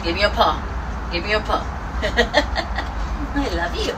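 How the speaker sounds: talking to a dog. Real, not acted. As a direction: coo and give commands like to a puppy. Training and praising her dog.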